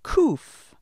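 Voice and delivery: Kuf with a hard throaty k; Hebrew letter Kuf. Shown as a demonstration. Teacher modelling Kuf for learners to repeat. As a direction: say Kuf slowly for the class to echo. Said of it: "Repeat after me".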